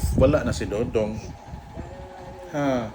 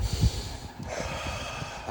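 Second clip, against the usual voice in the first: long, soft breath out; demonstrated exhale; 9 dB quieter than usual. Shown as a demonstration. Parent guides child to calm, exhaling. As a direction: breathe out slowly and audibly.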